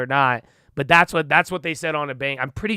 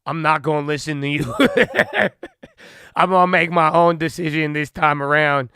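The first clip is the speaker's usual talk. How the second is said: louder, defiant, with a laugh mid-quote; mock defiant young voter; 2 semitones higher, 5 dB louder than usual. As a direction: voice young people shrugging off the critics. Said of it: "they're like".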